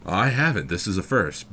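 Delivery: high, loud comic retort; emphatic protest. Retold or imitated. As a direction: blurt it out in mock dismay. Retold protest to reassuring customer.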